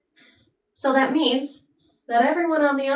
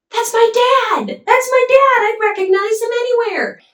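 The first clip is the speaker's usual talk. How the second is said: put-on much higher, louder, amazed little-boy cry; overjoyed child; 13 semitones higher, 7 dB louder than usual. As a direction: squeal it like a kid who just found his dad. Boy recognizes his father.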